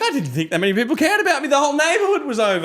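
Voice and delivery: high-pitched, rushed retold delight; imitating a thrilled, flattered nan; flattered excitement. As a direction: gush quickly about all the attention. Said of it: "She was actually quite flattered".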